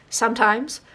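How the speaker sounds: normal talking voice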